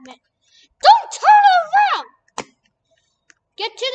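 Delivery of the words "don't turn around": high frantic scream; panicked screaming. Real, not acted. Chased in horror game.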